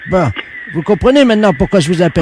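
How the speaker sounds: loud, put-on voice of an odd shop worker; a loud prank caller playing it dead serious. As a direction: fake tradesman on the phone, loud.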